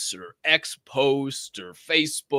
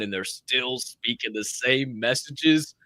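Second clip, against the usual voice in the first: usual speaking voice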